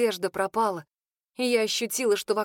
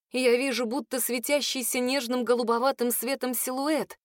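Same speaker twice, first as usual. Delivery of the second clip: ordinary delivery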